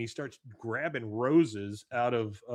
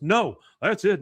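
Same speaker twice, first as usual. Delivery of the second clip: loud, higher acted refusal; mock indignation; 7 semitones higher, 10 dB louder than usual. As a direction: put your foot down, playing it up. Acted refusal, ending the deal.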